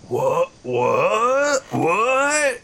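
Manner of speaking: rising baffled What, What, What; sputtering foiled villain. Acted. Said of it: "and he goes".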